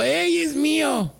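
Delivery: high, drawn-out retold call. Customer telling mother and son it's theirs, calling out. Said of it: "yo voy y les digo".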